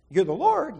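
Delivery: can't believe who it is; surprised. Retold or imitated. Jack hears the Lord answer, astonished.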